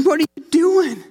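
a dad caught off guard by a slap; retelling his shock at the son's slap; stunned disbelief. Retold or imitated. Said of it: "And I'm thinking".

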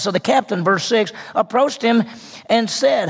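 normal talking voice

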